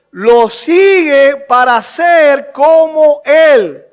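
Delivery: loud, high, insistent sermon delivery; building to emphatic, drawn-out preaching; preaching at full force. Real, not acted. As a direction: drive the point home to the congregation.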